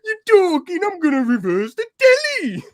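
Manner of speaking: squeaky high impression of a mate; mocking falsetto impersonation. Inside joke quoting mate.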